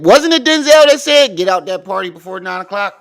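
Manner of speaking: high, loud and excited. Real, not acted. To friends about Denzel, high excited shout. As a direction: shout it out, eager and worked up.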